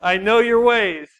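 slow, loud, laughing mock menace; slow mock threat. Pastor to a rival pastor, slow loud mock menace. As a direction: hams up a slow villain line, laughing. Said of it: "I was like".

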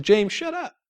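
short rebuke breaking into a laugh; amused quoted rebuke. Retold or imitated. Holy Spirit telling James to be quiet, laughing rebuke. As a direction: quote it fondly with a chuckle. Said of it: "He said".

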